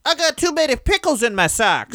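high-pitched wacky sketch-comedy lady. Acted. Podcaster parodying a Saturday Night Live sketch, wacky lady voice. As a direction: squawk out a silly failed catchphrase. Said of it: "just a wacky lady in a restaurant".

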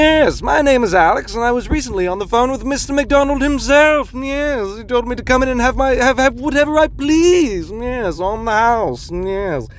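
haughty fake-important voice, claiming a free meal; hammed-up entitled customer; mock posh self-important caller. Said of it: "and I was like".

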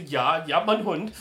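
loud, drawn-out punchline through laughter; joking retold reply. Host retells a joke about the breed name, laughing punchline. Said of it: "And I go".

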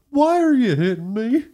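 high, whiny donkey voice; mock donkey asking why; talking donkey. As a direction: plead like a hurt donkey. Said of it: "it's like".

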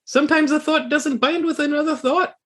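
high squeaky voice of the odd companion; Talks to herself voice. Acted. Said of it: "and she says".

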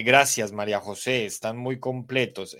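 ordinary delivery

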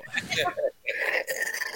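breathy burst of real laughter; wheezing giggle. Real, not acted. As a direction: crack up at the joke.

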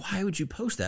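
high-pitched retold disbelief; incredulous reaction. Reacting to someone's embarrassing online video, mock incredulous.

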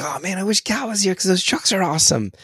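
high, gushing truck excitement; excited. Retold or imitated. Dad alone in the car, excited about trucks. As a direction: gush over the trucks like a kid. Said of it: "I'm getting excited".

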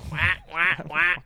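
quacking like a duck. Pretend. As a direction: do your best duck quack. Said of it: "can you sound like a duck?".

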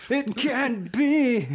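high slow stunned cry; anime hero shock. Retold or imitated. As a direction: gasp it out in disbelief, slowly. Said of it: "They always say the same thing".

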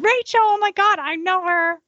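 high, starstruck mock squeal; excited fangirl. Coach pretends to spot her runner's book on the stand, starstruck. Imagines seeing friend's book on sale.

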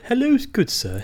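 high-pitched mock posh English greeting; cliche posh English accent. Asking co-host which cliche accent he means, mock posh English. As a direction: put on a high, over-proper English accent. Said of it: "like, the cliche accent".

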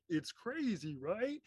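high, put-on cartoon kid voice; mock-excited babies, pitch swooping up to a question; imitated Muppet Babies. Muppet Babies reacting to the trip, mock high kid voices.

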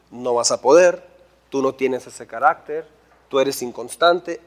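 low, measured voice of doubt; discouraging inner voice. Acted. The devil's voice discourages someone approaching God, low and insinuating. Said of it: "viene una voz que te dice".

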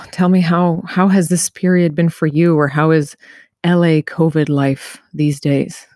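ordinary talk

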